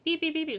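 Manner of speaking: high, loud electronic beeps; imitated car beeping. Mimicking the car's warning at him, high beeps. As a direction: sound like the dashboard alarm.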